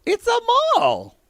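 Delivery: very high, squealing wonder; mock amazement. Gushing to co-host about the sky mall, high mock amazement. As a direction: gush in a tiny high voice.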